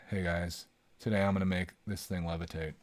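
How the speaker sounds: low monotone, barely any lift; David Blaine impression. Streamer imitates David Blaine's levitation intro, flat monotone. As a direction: drone it like a bored magician. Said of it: "the most monotone".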